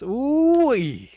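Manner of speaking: loud, high cowboy whoop; excited whoop. Acted. Texan detective whooping to the lawyer about ribs, loud and high. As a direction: holler it like a cowboy tasting ribs.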